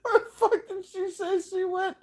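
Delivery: very high, loud cry-laugh; laughing wail. Real, not acted. Player lost in the game, crying with laughter. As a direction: wail it while cracking up.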